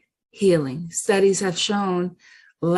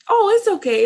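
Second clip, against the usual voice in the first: frog voice; 11 semitones higher, 6 dB louder, faster than usual. Retold or imitated. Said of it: "the frog says to her".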